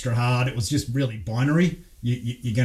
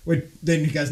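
normal talking voice

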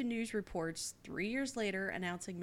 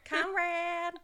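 put-on high, loud Southern-accented call; drawn-out pretend calling out; mock holler; 11 semitones higher, 7 dB louder than usual. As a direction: holler a name across the yard, hammed up. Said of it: "call his name and holler".

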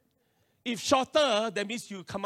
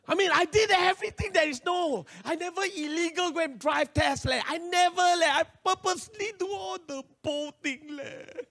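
plaintive protest; 7 semitones higher than usual. Retold or imitated. Learner complaining to God over lost IC, plaintive. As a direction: plead innocence in a whiny high voice.

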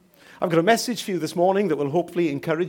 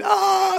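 high-pitched, louder mock "ah"; falling cry; 5 dB louder than usual. Man falls down the cliff, high acted cry. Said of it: "I think he's crying".

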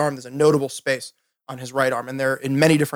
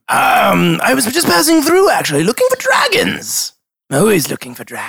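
high character voice; 5 semitones higher than usual. Acted. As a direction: play it high and showy. GM voices dragon.

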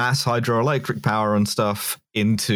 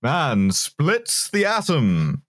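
slow, high, grand newsreel voice; 1950s narrator; 4 semitones higher, much slower than usual. Pretend. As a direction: announce it slowly like a fifties science film. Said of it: "a nineteen fifties science voice".

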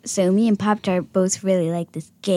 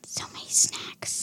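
soft, breathy whisper; 10 dB quieter than usual. Real, not acted. Daughter whispers to mom about too many snacks. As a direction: whisper it like a secret.

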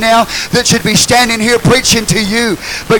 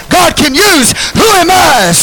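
high shouted call and response; preaching call; 10 semitones higher, 6 dB louder, slower than usual. Real, not acted. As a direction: lift it high for the crowd. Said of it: "help me preach".

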